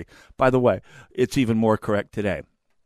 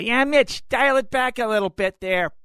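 lightly mocking impression of a liberal senator, pitched up; Paul Wellstone; 13 semitones higher than usual. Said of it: "the best Paul Wellstone impression I could manage".

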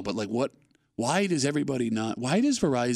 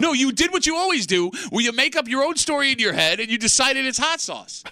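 mock indignant; 10 semitones higher, 3 dB louder than usual. Mock argument over hot sauce.

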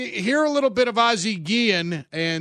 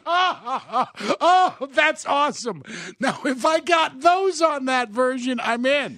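high-pitched, thrilled reaction; really thrilled, voice jumping up; excited delight; 6 semitones higher, slower than usual. Real, not acted.

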